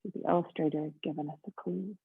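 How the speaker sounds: ordinary delivery, nothing marked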